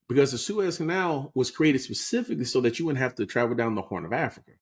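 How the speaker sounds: ordinary talk, nothing marked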